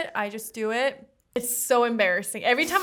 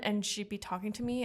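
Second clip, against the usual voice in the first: ordinary talk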